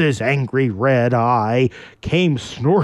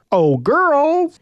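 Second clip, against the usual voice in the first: high, loud call across the glen; shout; 14 semitones higher, 4 dB louder than usual. Acted. Orion calling loudly to the seven girls, high shout. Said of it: "he shouted".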